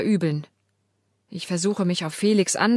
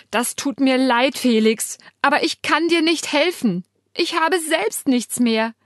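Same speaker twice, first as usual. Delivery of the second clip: desperate confession; 7 semitones higher, 3 dB louder than usual. A narrator's reading.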